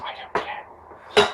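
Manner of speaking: slow whisper, don't care at all; whispered shrug. Real, not acted. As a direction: breathe it out slowly, dismissive. Dismissive aside after naming dates.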